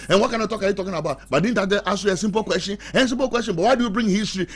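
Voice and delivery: high, loud, fast indignant comeback; wife snapping back. Retold or imitated. Wife arguing back at her husband, high and loud. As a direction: throw it back at him, outraged. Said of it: "Then she also say".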